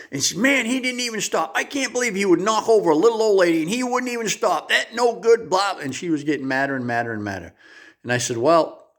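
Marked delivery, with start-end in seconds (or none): 0.4-5.8 put-on higher, indignant old woman ranting; angry old lady. Little old lady fuming about the driver, high indignant rant. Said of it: "And she was angry".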